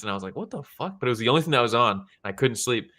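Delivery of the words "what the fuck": high, softer incredulous aside; mock disbelief. Retelling his reaction to a horrible show, mock disbelief. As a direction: squeak out a baffled reaction, quietly.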